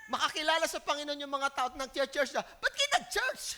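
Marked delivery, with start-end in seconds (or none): 2.4-3.4 much higher, slow complaining Jonah; sulky Bible study leader mocking the converts; mock whiny Jonah. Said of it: "siya yung nagba-Bible study". (nagrereklamong Jonah, mataas at mabagal)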